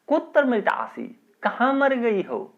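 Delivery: high, slow scolding demand; angry scolding. Acted. Mother-in-law angrily demanding where Bimla went.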